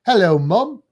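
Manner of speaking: loud British-accented hello; British version for a side-by-side comparison. Shown as a demonstration. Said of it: "kind of the British version".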